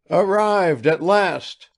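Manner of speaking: put-on high, slow old man's cry of joy; elated father calling out; exclaiming. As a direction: greet them as they pull up. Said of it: "exclaimed Bazarov's father".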